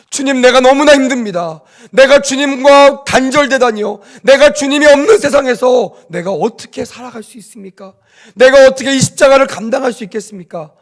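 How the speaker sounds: high, loud anguished plea to God; anguished cry. Acted. Jesus on the cross crying out to God, high and loud. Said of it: "목 놓아 호소하고 있는".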